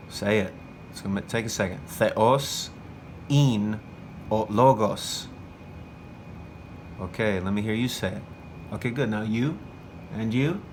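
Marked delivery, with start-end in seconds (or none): 1.9-5.3 word by word, with pauses between; spaced-out phrase for students to repeat; deliberate dictation. Shown as a demonstration. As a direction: dictate firmly, leaving gaps after "Theos" and "in".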